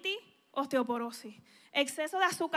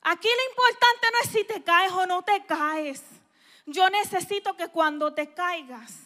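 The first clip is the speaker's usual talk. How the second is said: usual speaking voice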